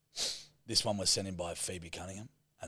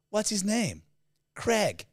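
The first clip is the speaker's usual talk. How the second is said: put-on American accent; 11 semitones higher, slower than usual. Gushing American friend naming her new boyfriend, high and bright. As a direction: squeal the name like a smitten American. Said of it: "the way Americans pronounce the name Craig".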